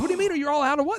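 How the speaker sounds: half-hammed puzzled customer; host retells asking the clerk, mock incredulous question. Retold own puzzled reply.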